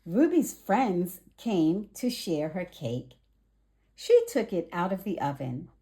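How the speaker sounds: ordinary delivery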